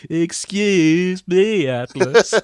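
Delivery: very drawn-out, loud "excuse me"; milk the catchphrase, high and indignant; hammed-up exasperated heckle; nineties Brooklyn man. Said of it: "that's how he responds to".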